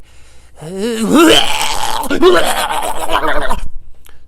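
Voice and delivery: loud, long retching noise; imitated vomiting. Comparing losing control to throwing up, loud mock retching. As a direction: heave a big exaggerated throw-up roar. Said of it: "once you start throwing up".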